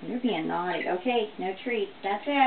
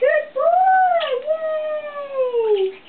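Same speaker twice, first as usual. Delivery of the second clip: very high, loud squeal of praise; 10 dB louder, much slower than usual. Real, not acted. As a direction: squeal with delight at the bird. Praising parrot after trick.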